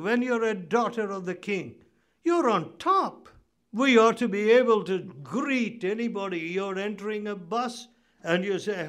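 ordinary delivery

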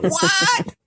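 imitating the listeners' stunned reaction, squeaked very high; mock shocked what. Host mimicking listeners hearing the price, mock shock. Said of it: "Everybody went".

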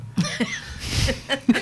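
airy, high laugh; breathy laugh. Real, not acted. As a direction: laugh it out almost silently. Laughing over the name search.

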